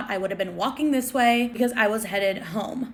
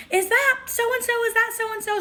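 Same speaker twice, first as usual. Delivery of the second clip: very high-pitched mock squeal; imitated fan scream; 13 semitones higher than usual. Girl running up to a celebrity's guard, mock screaming. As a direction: squeal it like a frantic fangirl. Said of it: "another girl runs up screaming".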